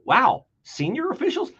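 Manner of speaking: high, wide-eyed mock "Wow"; hammed-up surprised question; mock astonishment. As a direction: feign shock at the phrase. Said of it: "pause over and go".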